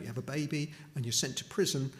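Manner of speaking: ordinary talk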